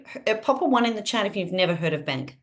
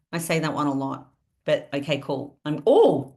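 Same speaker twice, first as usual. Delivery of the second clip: ordinary talk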